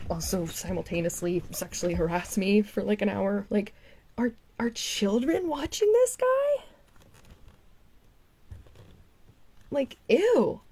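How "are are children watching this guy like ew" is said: high, slow, scandalised; appalled. Real, not acted. Asking the viewers if children watch him, appalled. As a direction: sound horrified at the idea. Appalled at his young audience.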